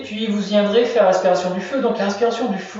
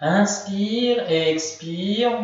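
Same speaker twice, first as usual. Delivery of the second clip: drawn-out, slow breathing cue; 2 semitones higher, much slower than usual. Shown as a demonstration. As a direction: stretch each word like a long breath. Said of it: "guides breath in a relaxation".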